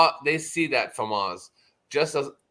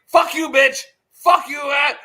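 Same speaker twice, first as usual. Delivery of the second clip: yelling it out, much higher and louder; angry shout; 10 semitones higher, 9 dB louder than usual. Retold or imitated. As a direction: blast it like a furious streamer. Retelling Oz cursing at a girl.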